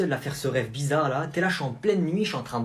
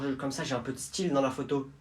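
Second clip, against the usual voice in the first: normal talking voice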